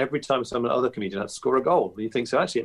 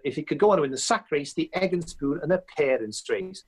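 lightly voiced pundit, higher and quick; a hint of the commentator voice, quicker; Colin Jackson impression; 7 semitones higher than usual. Comedian reworking his Colin Jackson line, light impression. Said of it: "so then one night I just said".

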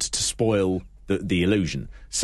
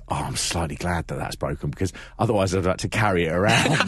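mock wrestler grumble; 6 semitones lower than usual. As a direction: mutter it like a jaded wrestler. Imagined Bad News Brown remark.

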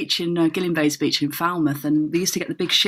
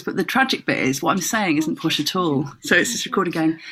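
ordinary delivery

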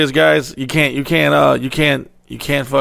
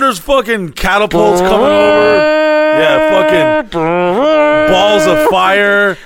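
hyped-up war storytelling; 9 semitones higher, 3 dB louder, slower than usual. Pretend. Hyped siege description.

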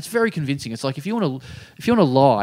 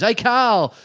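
eager bloke; 8 semitones higher than usual. Retold or imitated. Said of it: "the guy comes up and goes".

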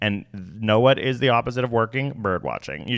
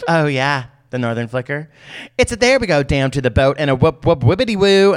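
rising, louder, hammed-up birder whooping the call; mocking bird watcher; 5 semitones higher, 5 dB louder than usual. Mocking eager bird watchers imitating calls, louder. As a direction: ham up a keen birder. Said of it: "Every single bird watcher is like".

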